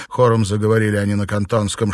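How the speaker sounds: usual speaking voice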